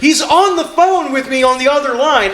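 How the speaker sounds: high, agitated mock yell; retold yelling. Boss yelling to employee about the other call, high-pitched mock yell. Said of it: "he just starts yelling into the phone".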